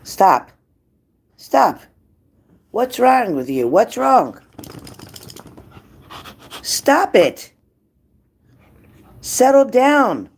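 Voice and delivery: yelling at the misbehaving dog to settle down. Real, not acted. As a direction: shout the dog down, then slow settle down. Dog acting up, she yells.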